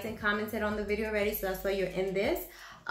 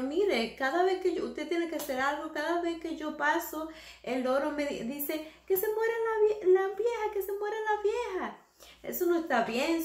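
put-on higher complaining old woman, lightly done; lightly voiced old lady; 7 semitones higher than usual. The old lady complaining to the parrot's owner, higher voice. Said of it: "fue a decirle al dueño".

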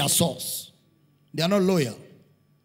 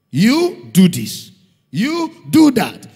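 commanding; 5 semitones higher, 8 dB louder, much slower than usual. Acted. Mimics church instructions.

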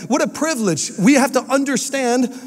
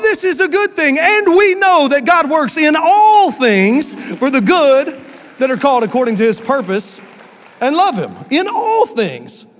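high and loud, slowing on all things; preaching climax; 6 semitones higher, 3 dB louder than usual. Acted. Preacher rallies the church at full force. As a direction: build it to a shout of faith.

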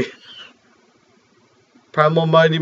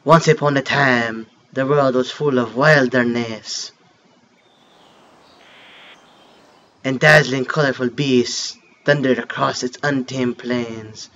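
softer, lower storytelling read; story narration; 4 semitones lower, 4 dB quieter than usual. Acted. Gamer reading the game's opening story, soft narration. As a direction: read the legend like a mock French narrator. Reads game intro narration aloud.

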